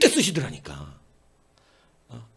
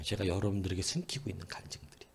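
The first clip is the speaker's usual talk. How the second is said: whisper; 6 semitones lower, 10 dB quieter than usual. Real, not acted. Pastor confides hidden testimonies.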